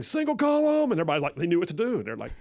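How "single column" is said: a high, sudden command yell. Retold or imitated. General commands his men, high shouted order. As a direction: bark the order across the arena. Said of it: "he's yelling".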